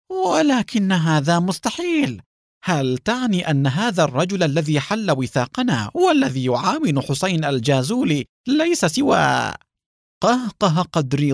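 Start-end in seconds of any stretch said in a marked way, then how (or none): 0.1-9.5 high, alarmed disbelief; panicked. A narrator's reading. Haim realising he has been tricked, panicked. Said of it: "وقال بذعر".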